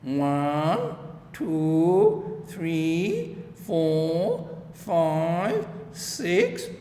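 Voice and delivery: put-on sly old gardener gloating over his catch; Mr McGregor counting. A narrator's reading. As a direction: count them up with a chuckle in the voice. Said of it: "replied Mister McGregor".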